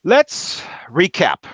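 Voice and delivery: slow drawn-out detective line; gruff cop opener. Acted. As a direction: announce it like a TV cop. Cop detective opens scene.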